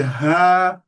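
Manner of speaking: loud call to make someone turn; attention call. Shown as a demonstration. Teacher showing the alerting call, loud. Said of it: "So you say".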